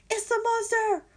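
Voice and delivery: very high, loud screech; screeching in fright. Acted. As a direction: shriek it out in panic.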